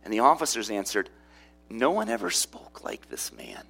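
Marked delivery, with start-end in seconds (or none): 1.7-3.6 hushed, as if still stunned by him; awed whisper. Acted. Officers answer the chief priests in an awed whisper. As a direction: whisper it, breathless with wonder.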